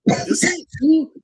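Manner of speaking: high, slow mock fan voice; skeptical fan. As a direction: pitch it way up and drag it out in doubt. Fans doubting the autograph picture.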